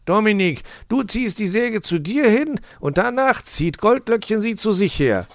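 high, loud fox giving orders; instructing. A narrator's reading. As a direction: direct them loudly, step by step. Fox directs the sawing couple.

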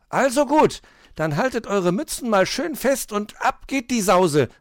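high, loud little hedgehog; excited call. A narrator's reading. Hedgehog launches the sledge downhill, excited high call. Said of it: "rief Borstel".

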